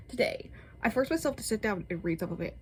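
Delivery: ordinary talk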